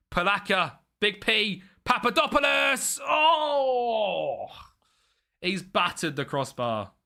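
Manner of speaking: building to a loud, high shout; excited commentary. Real, not acted. Commentating a near goal.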